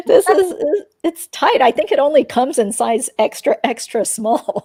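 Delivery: loud giggly embarrassment; laughing embarrassed. Real, not acted. Laughing to her co-hosts about the tiny tank top. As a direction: laugh through it, a bit loud.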